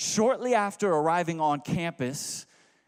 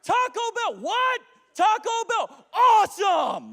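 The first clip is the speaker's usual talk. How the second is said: much higher, shouted back and forth with laughter; yelling over engine noise. Retold or imitated. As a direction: yell to be heard over a roaring car. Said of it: "we'd be driving just yelling".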